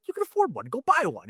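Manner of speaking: very high mock urging; high, teasing retold nudge; mock own urging. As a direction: push him, voice way up. Retold exchange with dad.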